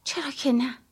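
softly, almost whispered; wistful sigh. A narrator's reading. Mother giving in to the party, wistful and hushed. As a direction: give in with a soft sigh. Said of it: "آهی از سر حسرت کشیدم".

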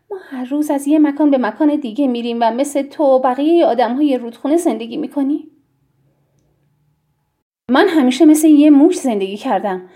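angry. A narrator's reading. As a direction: throw the questions back in anger. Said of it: "به گمانم عصبانی بود".

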